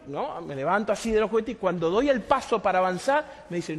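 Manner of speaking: usual speaking voice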